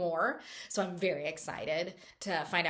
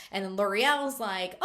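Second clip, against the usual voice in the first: normal talking voice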